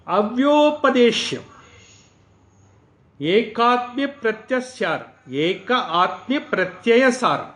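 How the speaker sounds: slow, measured chant of the Sanskrit verse; a teacher intoning scripture word by word; mantra recitation. Acted. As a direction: recite each word with a pause.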